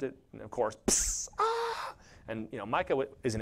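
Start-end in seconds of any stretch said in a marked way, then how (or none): 1.4-1.9 put-on sudden high yelp of a burnt toddler; a sharp little pained cry; imitated child scream. Child touches hot stove.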